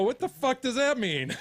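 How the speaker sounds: loud, high outraged yell; mock outraged shout. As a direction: blast the question out, furious. Angry retold text to friend.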